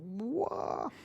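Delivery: jaw-dropped what; stunned what. Retold or imitated. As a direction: breathe it in awe. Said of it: "I'm just like".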